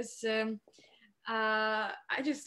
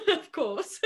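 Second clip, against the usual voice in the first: laughing aside, higher and louder; 13 semitones higher, 7 dB louder than usual. Real, not acted. Guest joking that the audiobook is period drama, laughing. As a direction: chuckle the words.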